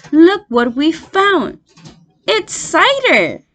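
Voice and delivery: high, loud thrilled cry; excited cry. A narrator's reading. Young fox calling to his father, thrilled. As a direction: show him the treasure with delight. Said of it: "he cried out".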